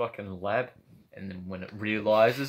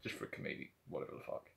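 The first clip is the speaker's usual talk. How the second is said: whisper; 19 dB quieter, faster than usual. Retold or imitated. Director voiced, muttering.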